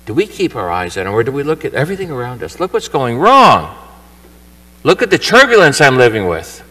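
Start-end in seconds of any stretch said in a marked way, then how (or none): 2.6-6.6 louder, higher, slowed fretful complaint; mock anxious outcry. Believer staring at the storm, complaining louder. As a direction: fuss over the turbulence around you.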